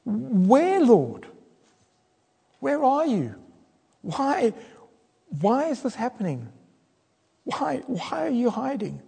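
high, searching questions that fade; pleading complaint. Acted. Preacher voicing a faithful complaint to God. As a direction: shake your fist at God, then soften. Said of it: "faithfully shaking our fists at God and asking".

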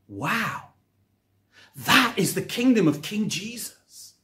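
ordinary talk, nothing marked

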